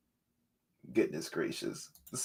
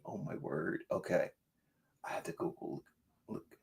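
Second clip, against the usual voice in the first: whisper; 7 semitones lower, 17 dB quieter than usual. Real, not acted. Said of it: "whispered aside".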